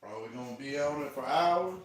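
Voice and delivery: ordinary talk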